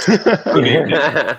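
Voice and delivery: high, loud, drawn-out greeting amid laughter; slow, playful in-character hello; amused greeting. Acted. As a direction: ham up the corrected greeting.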